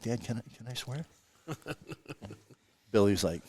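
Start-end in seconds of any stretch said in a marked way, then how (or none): 0.0-1.1 soft, tentative question; imitated kid asking permission. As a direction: ask Dad quietly, as if for permission. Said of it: "and he's like".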